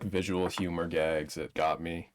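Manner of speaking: ordinary talk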